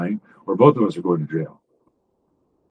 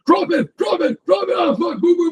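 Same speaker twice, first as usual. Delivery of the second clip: much higher, yelled police commands; shouted commands. Shown as a demonstration. Coach demonstrating a shootout to the host, high yelled commands. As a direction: bark it at a suspect who won't drop the gun.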